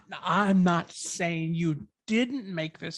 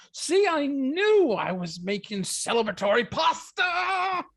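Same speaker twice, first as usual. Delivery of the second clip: excited cheer; 7 semitones higher than usual. Acted. Cheering her new job.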